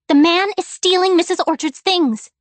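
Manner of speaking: high, loud and breathless; girl warning her father, alarmed and loud; urgent child. A narrator's reading. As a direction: blurt it out in alarm. Child reports theft to father.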